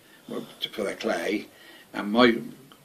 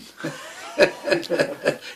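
unvoiced laugh; 6 semitones higher than usual. Real, not acted. Laughing at the woman's letter.

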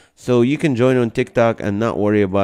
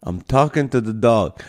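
ordinary delivery

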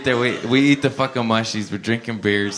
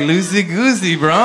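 higher, louder sing-song throwback; mocking echo; 7 semitones higher, 4 dB louder than usual. Tripping driver echoing his buddy, giddy and loud. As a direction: toss his words right back, giddy. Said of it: "I'm like".

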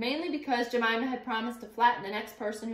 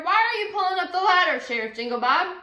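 shouting; 7 semitones higher, 8 dB louder than usual. A narrator's reading. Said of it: "shouted the mayor".

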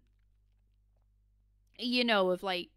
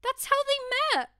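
excited realization; 11 semitones higher, 6 dB louder, slower than usual. Real, not acted. Sudden realization, raised voice.

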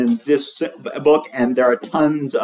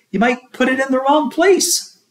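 high teacher voice; 11 semitones higher than usual. A narrator's reading.

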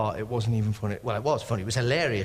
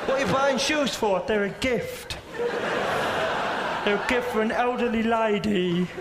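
higher-pitched dialogue in a creature voice; placid stingray; 4 semitones higher than usual. Acted. Stingray explaining a gift for an elderly lady, placid creature voice. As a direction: play a harmless stingray talking about shoes. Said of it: "That's how placid they are".

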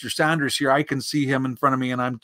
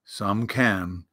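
low, glum donkey; gloomy Eeyore; 5 semitones lower, 3 dB quieter than usual. A narrator's reading. Donkey answering happy Pooh, gloomy. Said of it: "said Eeyore gloomily".

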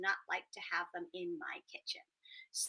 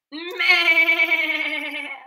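loud high goat meh; shouted goat noise; goat bleat; 7 semitones higher, 16 dB louder than usual. Acted. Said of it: "the goat would go".

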